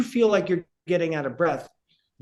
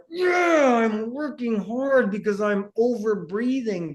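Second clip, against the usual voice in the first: mock proud athlete; 7 semitones higher, slower than usual. Breathing coach mimicking a proud athlete, hammed-up boast. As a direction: shout the brag like a winded athlete.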